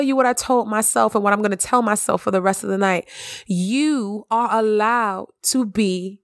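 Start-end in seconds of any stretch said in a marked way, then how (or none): none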